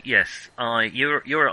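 ordinary delivery

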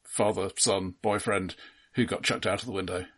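ordinary delivery

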